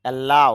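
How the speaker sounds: Allow with a pressed second syllable; stress on LAU. Shown as a demonstration. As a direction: lean on the লাউ in allow. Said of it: "লাউর মধ্যে চাপ দিতে হবে". (অ্যালাউ-এর লাউ অংশে চাপ)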